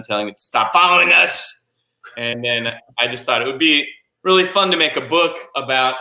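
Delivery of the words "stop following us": mock yell at the moon, pitched up; retold yell. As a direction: call it out higher, like a retold shout. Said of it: "we started yelling at the moon".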